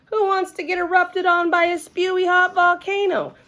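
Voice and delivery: put-on high-pitched kid hollering in protest; outraged little-girl holler, pitched well up. A narrator's reading. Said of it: "she hollered".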